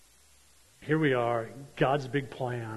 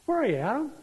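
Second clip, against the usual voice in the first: voicing God calling; 12 semitones higher, 4 dB louder, faster than usual. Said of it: "He knew where they were at".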